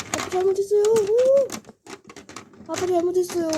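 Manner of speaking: high tearful plea to dad; begging apology. Acted. Child scolded by dad, high tearful begging. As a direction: whimper sorry in a high voice.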